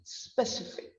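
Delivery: "specific" said softly as SPESS-ific; quiet "specific", starting spess; SPESS first syllable. Shown as a demonstration. Teacher modelling specific after spelling it, soft SPESS-ific. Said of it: "It's like S P H A".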